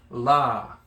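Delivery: "la" with a long, held ah; elongated vowel. Shown as a demonstration. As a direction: stretch out the vowel in "la", letting it fall. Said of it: "elongates the la".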